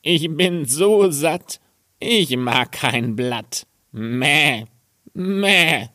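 high goat voice, slow and satisfied, bleating at the end; a full, pleased goat bleats its answer; contented goat. Acted. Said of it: "Und die Ziege antwortete". (hohe, langsame, zufriedene Ziegenstimme)

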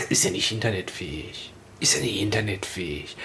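hushed, whispered objection; mock whisper. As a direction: whisper it like a confidential put-down. Nachgespielter Einwand, geflüstert. (gespielt geflüstert)

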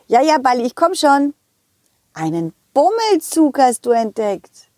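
usual speaking voice

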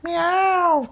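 very high kitten miaow; high cat meow. Pretend. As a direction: squeak it like a kitten. Echoing toddler's meow.

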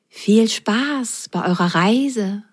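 slow, cat-like voice; meowing cat. Acted. The cat says goodbye to the socks, slow and catlike. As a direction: purr it out like a cat. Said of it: "maunzte er".

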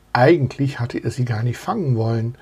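ordinary delivery, nothing marked

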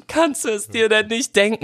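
very high, squeaky fake crying voice; mock tearful whine. Crying child hinting to mother, exaggerated tearful whine. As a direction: imitate yourself wailing, hammed-up. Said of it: "hab dann geheult".

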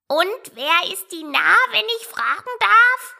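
high put-on bird voice; parrot voice. A narrator's reading. Parrot asking Alice who Dinar is, high-pitched. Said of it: "sagte der Papagei".

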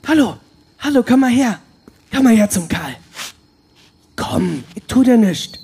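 high, gentle coaxing of a small bird. Acted. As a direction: lure the sparrow closer, sweetly.

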